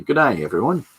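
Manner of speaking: high, loud mock Australian greeting; hammed-up Aussie "Good day" to the viewers; silly Australian accent. Artist hailing his stream viewers in a jokey Australian accent. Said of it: "I can't help doing silly accents".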